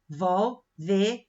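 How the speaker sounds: "revolveres" bem devagar, sílaba por sílaba, com pausas; separando em sílabas. Shown as a demonstration. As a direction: isolar cada sílaba de "revolveres", deixando o RS final sem vogal. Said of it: "eu vou separar revólver". (silabação didática de "revolveres", pausada)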